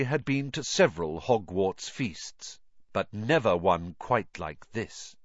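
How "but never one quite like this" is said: usual speaking voice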